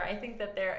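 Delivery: usual speaking voice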